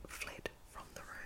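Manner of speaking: ordinary delivery, nothing marked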